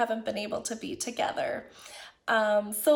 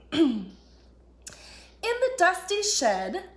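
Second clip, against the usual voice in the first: gentle, higher storybook narration; reading to children; 3 semitones higher than usual. A narrator's reading. Teacher reading a story to children, gentle storybook voice. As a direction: read it like a picture book to little ones.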